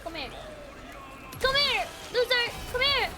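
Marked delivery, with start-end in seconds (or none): 1.4-3.1 taunting call. Real, not acted. Streamer chasing an enemy, gloating call. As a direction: lure the loser in, gloating.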